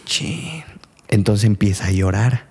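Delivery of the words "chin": dismayed mutter. Acted. Father sees his daughter.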